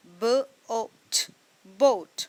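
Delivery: ब, ओ, ट said slowly and apart, then बोट; sounding out letters. Shown as a demonstration.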